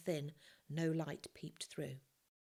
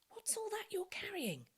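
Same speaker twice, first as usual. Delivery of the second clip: hushed question. Acted.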